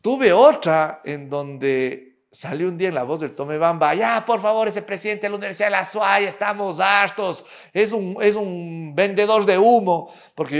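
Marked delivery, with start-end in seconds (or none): 3.9-10.1 remedo de oyente harto, en tono más agudo; queja indignada imitada. Retold or imitated. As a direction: imita una protesta airada por radio, alargando las palabras finales. (remedo de queja exasperada)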